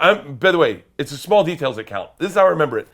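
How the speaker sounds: ordinary delivery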